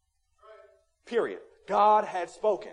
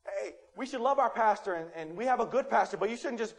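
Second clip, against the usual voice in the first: ordinary delivery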